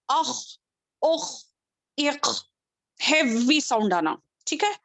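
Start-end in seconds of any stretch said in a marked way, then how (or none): 0.1-2.4 اخ said with a heavy rasping kh from the throat; heavy kh sound. Shown as a demonstration. Teacher showing students the heavy sound of اخ. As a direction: scrape a heavy kh. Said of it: "اس کو کیسے پڑھیں گے؟".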